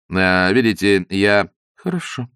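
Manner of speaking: ordinary delivery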